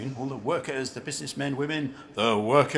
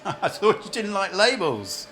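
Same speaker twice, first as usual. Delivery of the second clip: laughing, higher and louder; teasing laugh; 6 semitones higher, 6 dB louder than usual. Acted. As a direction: laugh as you tease her. Teasing the young woman.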